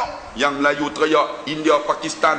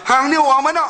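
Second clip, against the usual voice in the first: northern dialect question; 7 semitones higher than usual. Retold or imitated. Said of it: "Saya pun tanya".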